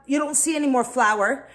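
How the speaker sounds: ordinary talk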